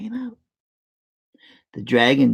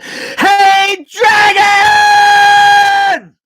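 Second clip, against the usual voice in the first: much higher and louder shout; 11 dB louder, much slower than usual. A narrator's reading. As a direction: yell right into his ear. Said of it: "she shouted as loud as she could".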